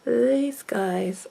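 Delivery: breathy whispered confession. Real, not acted. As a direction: whisper it like a shameful secret. Confessing biggest pet peeve.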